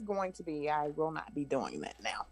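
whispered aside; whispering. Real, not acted. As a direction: drop to a whisper. Quiet aside.

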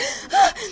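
sharp high frightened gasp; scared gasp. Retold or imitated. Retelling a ghost scare, a sharp high frightened gasp. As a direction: gasp in fright as if the light just came on.

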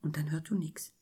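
hushed whisper. Retold or imitated. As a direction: breathe it out, no voice. Silence after the crash.

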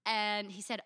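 usual speaking voice

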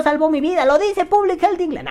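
high, loud parody of a fanatic; mock zealous outcry. Vaper citing English health authority, hammed-up zeal. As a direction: proclaim it like a hammed-up true believer.

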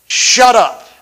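God cutting him off sharply; God's stern command. Retold or imitated. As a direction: bark it out as God's voice. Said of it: "He said".

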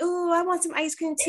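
put-on high whiny kid voice asking for ice cream; mimicking the kids wanting treats; kids begging. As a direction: beg like a little kid.

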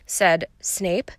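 usual speaking voice

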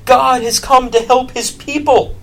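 high, loud proclamation; crowd crying out. Acted. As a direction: call it out to the whole crowd. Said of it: "they cried".

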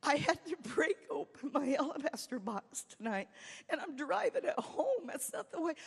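a touch of crying through a laugh; retold crying. Driving the car home, complaining to God, mildly tearful. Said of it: "I was crying".

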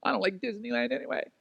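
high, small mock-pouty voice; sulky offended guest. Offended guest at Disneyland, high sulky muttering. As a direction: mutter a hurt retort, high and soft. Acted huff at park staff.

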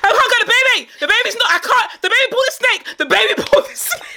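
high, laughing mock panic; panicked mom on the phone. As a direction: squeal it out like a frantic mother calling her husband. Friend acts out panicked mother.

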